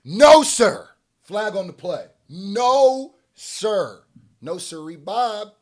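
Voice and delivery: loud, high mock-referee veto; emphatic refusal. Real, not acted. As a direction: call it out like throwing a flag. Refusing the rapper's excuse.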